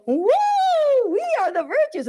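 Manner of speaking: high, hooting sung-ish reply; lightly chanted virtues' reply; virtues answering. Acted. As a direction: sing out from up in the tree. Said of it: "And then they go".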